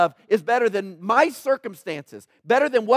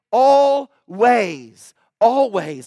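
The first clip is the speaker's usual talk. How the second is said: forceful repeated always; 7 dB louder, much slower than usual. Real, not acted. Preacher urging to seek God's face.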